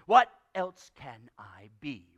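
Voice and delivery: slow, low, loud grumble; exasperated old uncle voice; Scrooge. Acted. Uncle answers nephew's plea not to be cross, grumbling. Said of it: "returned the uncle".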